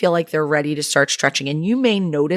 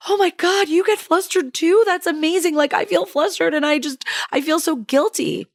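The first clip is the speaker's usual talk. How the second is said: much higher, gushing relief; a delighted mom finding she's not alone; thrilled mom; 11 semitones higher than usual. Retold or imitated. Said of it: "She was like".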